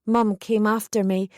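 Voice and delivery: ordinary delivery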